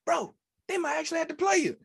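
very high, excited squeal; squeaky over-excited friend impression; amped-up buddy. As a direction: hype up your pal in falsetto. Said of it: "so Speedy once again".

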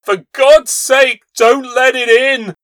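high, loud, slow terrified plea; terrified pleading. Acted. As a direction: beg in terror. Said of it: "cried the old man, trembling".

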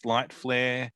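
normal talking voice

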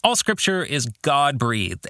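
ordinary talk, nothing marked

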